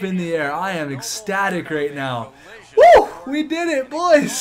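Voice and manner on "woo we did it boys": huge high whoop, then shouted much higher and louder; victory whoop. Real, not acted. Celebrating Cup win.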